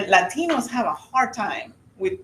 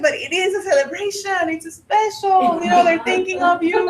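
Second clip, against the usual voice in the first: mock pleading; 11 semitones higher than usual. Wife retells arguing for a dawn birthday call, mock pleading. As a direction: plead the case in a high put-on voice. Acting out the birthday argument at home.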